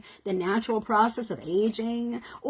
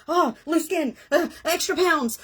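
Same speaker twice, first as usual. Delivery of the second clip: higher hammed-up complaint about the body; mock dismay; 6 semitones higher than usual. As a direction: act out fussing over flaws, lightly exaggerated. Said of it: "We go".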